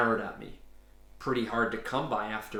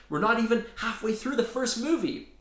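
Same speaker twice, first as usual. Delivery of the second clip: pitched way up, indignant; high-pitched protest; 11 semitones higher, 3 dB louder than usual. Acted. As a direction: plead in a panicked squeak.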